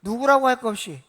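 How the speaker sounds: loud call for the congregation to repeat. Shown as a demonstration. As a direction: lead them in saying it out loud. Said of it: "크게 좀 해보세요". (크게 따라 하도록 힘주어)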